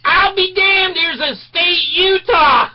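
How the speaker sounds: very high, loud, slow amazement; astonished concession. Retold or imitated. Attendant tells his buddy Utah is real, astonished. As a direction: marvel at the plate. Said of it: "he looks at his friend and he goes".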